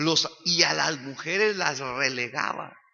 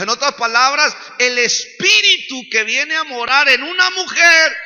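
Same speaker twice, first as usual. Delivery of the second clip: loud, high, emphatic sermon delivery; impassioned preacher hammering the point home; full-force preaching; 10 semitones higher, 9 dB louder than usual. Real, not acted. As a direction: drive each key phrase up to a shout.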